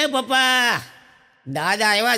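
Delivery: put-on a small boy shouting from the doorway; little boy calling out.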